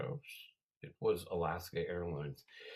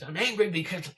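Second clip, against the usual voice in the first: loud, high outburst; hammed-up angry snap; sudden mock anger; 11 semitones higher, 9 dB louder than usual. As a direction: flip abruptly into an imitated angry yell. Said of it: "a switch".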